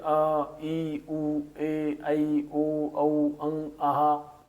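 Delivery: each vowel said clearly on its own; vowel sounds. Shown as a demonstration. Teacher sounds out the Hindi vowels for students. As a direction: sound out अ, ई, उ, ए, ऐ, ओ, औ one by one. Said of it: "जैसे".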